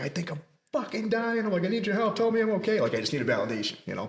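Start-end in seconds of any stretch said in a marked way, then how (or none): 0.0-2.8 high-pitched panicked yell, pitch way up; half-reenacted desperate scream for help; retold scream. To his father on the phone, a high panicked cry. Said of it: "So I started screaming".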